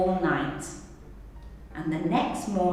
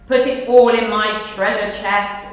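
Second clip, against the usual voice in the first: ordering; 9 dB louder than usual. Acted. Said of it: "ordered the captain".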